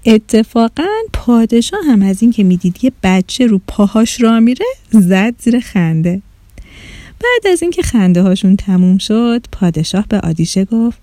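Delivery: plain reading voice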